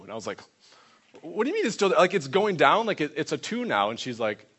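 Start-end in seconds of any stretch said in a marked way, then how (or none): 1.2-3.9 higher, mildly incredulous questioning. Retold or imitated. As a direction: challenge the answer in mock disbelief. Retells himself arguing with her answer.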